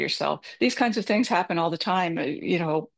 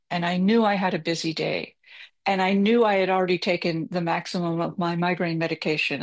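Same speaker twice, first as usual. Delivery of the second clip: usual speaking voice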